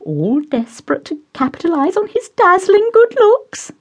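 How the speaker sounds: high, fluttery mother voice; high, gushing matron; doting society mother. Acted. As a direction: play the gushing hostess pouring tea.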